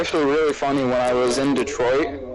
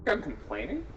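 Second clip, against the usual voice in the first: quiet question; 3 semitones higher, 11 dB quieter than usual. Real, not acted. Speaker asking if listener thinks so, quiet question. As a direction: ask softly, pitch swooping up at the end. Question not heard, asked again.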